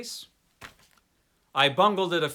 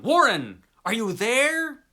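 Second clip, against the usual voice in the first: calling down; 9 semitones higher, 7 dB louder than usual. Acted. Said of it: "Again I called down".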